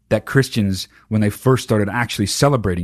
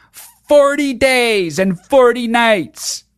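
put-on much higher, slow, sing-song know-it-all kid; bratty kid answer; 11 semitones higher, 4 dB louder, much slower than usual. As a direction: recite it like a smug little kid. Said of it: "some fucking shitty little kid would be like".

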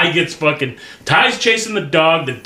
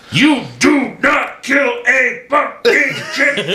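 high, slow, every word in "You do not kill a fucking chicken" punched separately; syllable-by-syllable scolding; 11 semitones higher, slower than usual. Retold or imitated. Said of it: "with every syllable he's hitting him".

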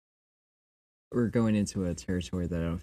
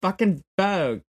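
high, loud, sassy procession leader; rally the parade in a high-pitched camp voice; campy queer voice; 11 semitones higher, 4 dB louder than usual. Acted. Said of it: "you were doing a queer voice".

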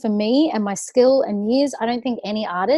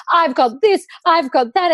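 mock boastful American; 7 semitones higher, 4 dB louder than usual.

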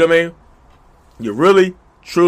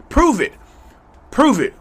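higher, firm "prove it"; demanding; 8 semitones higher, 4 dB louder than usual. Acted. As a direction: challenge them to back it up. Demanding proof.

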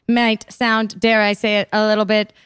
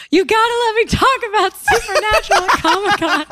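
higher, louder, laughing mock whine; mock pleading friend; 9 semitones higher, 4 dB louder than usual. Teasing cohost imitating her eager friend, laughing mock whine. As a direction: imitate an eager fan begging to gush. Mocking friend's constant Supernatural talk.

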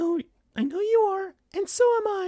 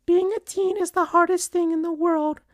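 earnest plea in high falsetto; put-on mother voice, pleading sorrowfully; very sad, meaning it. A narrator's reading. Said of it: "in a very sad and meaning it way".

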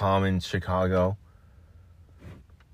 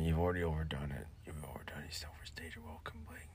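fast, breathless whisper; 5 semitones lower, 18 dB quieter, faster than usual. Pretend. Whispered repeat.

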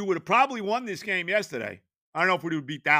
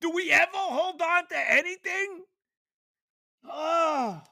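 1 despairing cry; 9 semitones higher than usual. Real, not acted. Fan despairing.

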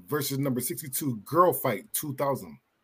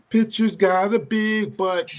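goofy mock voice; 7 semitones higher, 6 dB louder, slower than usual. Goofy quote after movie title.

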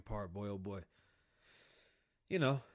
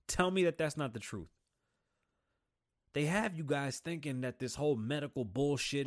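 usual speaking voice

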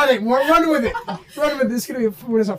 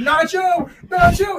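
loud, higher calls down the hall; calling a sleeping roommate, raised voice; 7 semitones higher, 4 dB louder, slower than usual. Retold or imitated. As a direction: shout his name to wake him.

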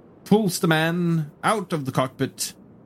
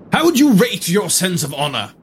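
formal gentleman voice; 6 semitones higher, 4 dB louder than usual. Acted. Herodotus interrogates the prisoner.

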